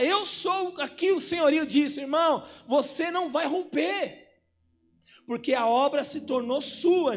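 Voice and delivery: full-force preaching. Acted. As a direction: drive it home to the congregation. (pregação inflamada, mais alta e mais aguda)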